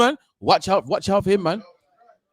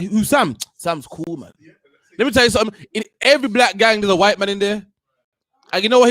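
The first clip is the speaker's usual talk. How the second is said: ordinary talk, nothing marked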